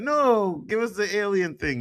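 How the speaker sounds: high-pitched playful whine of protest; mock protest. Retelling his letdown at the movie, in mock protest. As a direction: plead in exaggerated disappointment.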